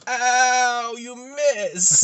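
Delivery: loud, high, drawn-out comic wail; pained mock cry. Fallen Flash complaining to the villain, high mock wail. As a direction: wail it as if just landed hard.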